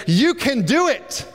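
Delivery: high, bright cheering on; a friend cheering you on; encouraging friend. Retold or imitated. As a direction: lift them up with belief. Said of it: "pat us on the back and say".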